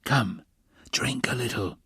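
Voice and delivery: soft, coaxing near-whisper; hushed, concerned coaxing. A narrator's reading. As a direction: gently urge a sick friend. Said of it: "he whispered".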